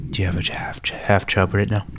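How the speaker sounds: mocking hush. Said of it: "we were just kinda making fun of it".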